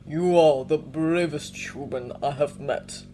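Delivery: slow, deep, solemn bear; low and grave like an old bear. Acted. Yorick voiced.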